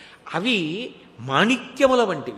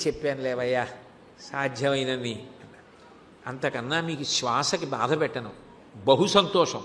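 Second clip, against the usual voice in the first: gentle reassurance; 7 semitones lower, 6 dB quieter than usual. Acted. Shiva tells the tired saint.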